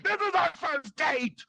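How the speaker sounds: high, drawn-out mortified protest; mock panicked boyfriend. Boyfriend protesting to the comic on a first date, mock panic. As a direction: push it up high and stretch each word.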